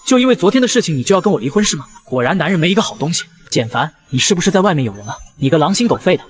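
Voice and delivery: usual speaking voice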